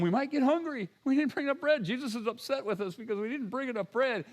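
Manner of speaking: mock fretting disciples. Disciples mocked fretting about bread.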